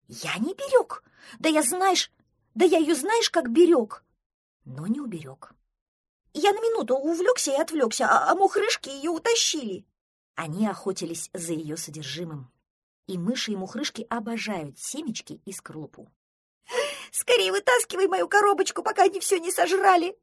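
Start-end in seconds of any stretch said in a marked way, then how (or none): 0.1-4.0 high, loud duckling indignation; indignant protest. Acted. Duckling blamed for not guarding his box, indignant. 6.3-9.9 high, loud duckling complaint; upset wailing. Acted. Duckling explains how he lost his box, upset wail. 17.1-20.2 high, loud tearful duckling; sobbing wail. A narrator's reading. Duckling begs for his box to be pulled out, wailing. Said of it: "зарыдал утёнок".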